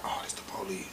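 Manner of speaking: very soft, barely voiced aside; retold hushed realization; hushed alarm. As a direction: say it under your breath, as if hiding. Hiding in closet, hears knocking.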